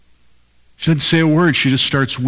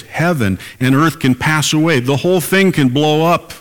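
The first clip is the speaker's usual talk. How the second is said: rising emphasis; 5 semitones higher than usual. Acted. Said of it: "Jesus said this".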